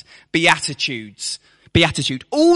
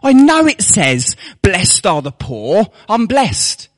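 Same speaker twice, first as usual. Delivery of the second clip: mock boast; 6 semitones higher, 4 dB louder than usual. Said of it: "If we just got to memorize".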